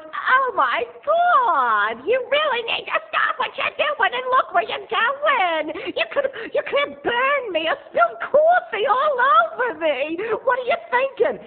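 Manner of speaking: shrill, very high and loud mock scolding; snooty scolding woman. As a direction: lecture him like a spoiled diva. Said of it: "She's like".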